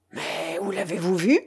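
understated astonishment in a slightly changed voice; astonished. A narrator's reading. Pirate asks where she saw her lover, astonished. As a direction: ask with a touch of amazement. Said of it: "demanda Argot étonné".

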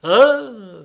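loud, high startled "huh?"; sudden jumpy yelp of a question. A narrator's reading. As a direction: blurt it out in alarm. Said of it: "il s'en effraya lui-même".

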